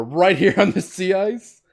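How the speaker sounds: slow, high, loud incredulous question; indignant. Acted. Cop challenging girl on the sea ice, loud indignation. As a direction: stretch it out in hammed-up outrage. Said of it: "Maybe add more indignation".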